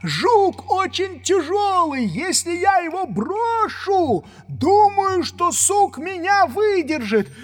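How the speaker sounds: high, loud, slow drawn-out call; shouting down. A narrator's reading. Jupiter shouts back from tree. (громко и протяжно кричит сверху)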